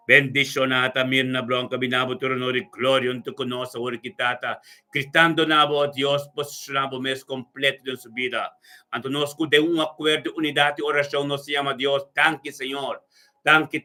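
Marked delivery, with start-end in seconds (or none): none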